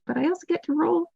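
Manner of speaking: breathy hushed relish of sweet sneak attack damage; gleeful hushed whisper; savoring whisper. Acted. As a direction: murmur it like a treat, high and soft.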